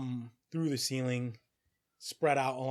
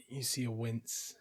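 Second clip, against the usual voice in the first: ordinary talk, nothing marked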